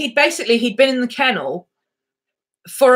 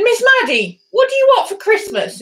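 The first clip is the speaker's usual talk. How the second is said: much higher, louder sing-song question to a dog; high teasing baby talk; high playful question; 13 semitones higher, 4 dB louder than usual. Retold or imitated. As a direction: ask it like talking to a toddler. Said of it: "And I said".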